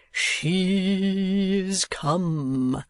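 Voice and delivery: put-on slow, lovestruck old man; a dreamy old suitor; besotted old gentleman. A narrator's reading. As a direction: hand on heart, he's smitten. Old gentleman swooning over lady.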